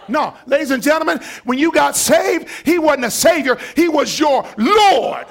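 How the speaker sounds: loud, high shouting sermon; full-force preaching. Acted. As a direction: preach it hard to the congregation. Preacher shouting the key point.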